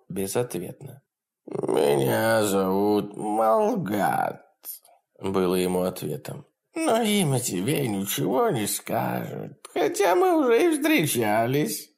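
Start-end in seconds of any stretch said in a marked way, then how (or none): 1.5-4.4 slow, breathy sinister whisper. Acted. Demon names himself. 6.7-11.9 high, gleeful imp voice; cackling hell torturer. Acted. Demon taunts.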